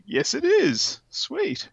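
high, delighted yes; thrilled to find it; excited. Retold or imitated. Retelling finding the comic.

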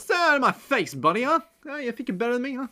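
high, loud, mocking street tough; mock tough guy. Jojo squaring up to the cabbie, high mock tough-guy taunt. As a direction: puff up and taunt like a street thug.

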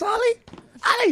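excited call. Real, not acted. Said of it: "calling to the dog in disbelief".

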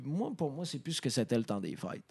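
ordinary talk, nothing marked

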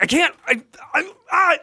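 high, stammering mock fluster. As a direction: imitate a man lost for words. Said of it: "you could see this internal fluster".

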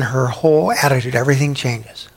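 ordinary delivery

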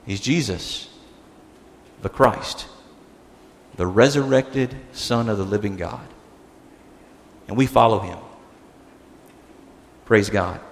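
soft, low, spaced-out phrases; reverent hush. Real, not acted. Preacher answering who is this Lord, reverently hushed. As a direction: close quietly with long pauses.

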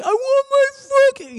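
very high, hammed-up wailing shout; tantrum yell. Gunman demanding his mom from police, mock tantrum. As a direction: bawl it like an overgrown child.

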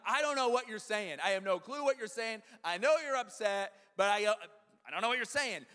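acted frustrated bafflement; exasperated dad. Acted baffled dad to crying son.

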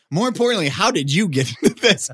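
high, loud incredulous asking; amazed question. Retold or imitated. The crew asking how he got into the party, high amazed. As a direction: ask it in playful disbelief. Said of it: "They're like".

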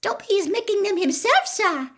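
very high, loud squeaky elf voice; happy elf. A narrator's reading. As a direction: squeak it proudly and happily as a little elf. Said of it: "the elf said happily".

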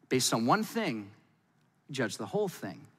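ordinary talk

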